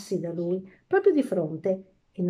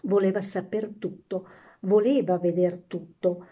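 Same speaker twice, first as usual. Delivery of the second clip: usual speaking voice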